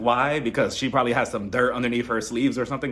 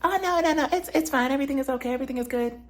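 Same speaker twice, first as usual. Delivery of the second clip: high, sing-song fake denial, much higher; faux innocent denial; 10 semitones higher, faster than usual. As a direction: play dumb in a bright falsetto as if nothing's wrong. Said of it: "she's playing dumb".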